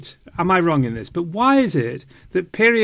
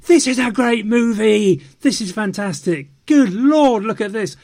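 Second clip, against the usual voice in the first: higher, louder hammed-up rave; mocking gushing fans; 6 semitones higher, 4 dB louder than usual. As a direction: overdo the fans' breathless delight. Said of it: "everybody started saying".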